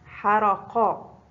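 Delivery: حرقا spelled out slowly, each sound clear; very slow classroom model of حرقا; slow example word. Shown as a demonstration. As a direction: stretch حرقا out for the pupils. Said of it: "حاء في أول الكلمة".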